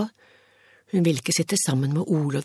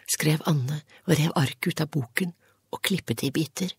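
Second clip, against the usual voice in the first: plain reading voice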